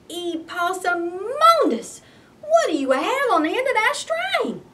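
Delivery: stare at the ruined bread in disbelief; mama aghast. Acted.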